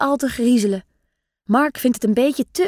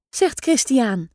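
ordinary delivery, nothing marked

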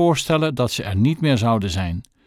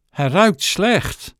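disgusted mother; 6 semitones higher, much slower than usual. Acted. Mother objects the tramp smells.

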